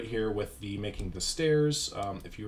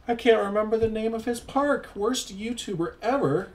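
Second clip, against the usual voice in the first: high, raised-voice self-scolding; mock dismay; 12 semitones higher, 5 dB louder than usual. YouTuber berating himself for forgetting a park's name, high mock anguish. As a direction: wail it high in hammed-up exasperation.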